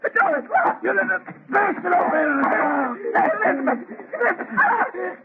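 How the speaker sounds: anguished sobbing shout; husband breaking down the door, sobbing her name; crying out. Acted. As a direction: cry out her name through tears. Breaking into her locked room.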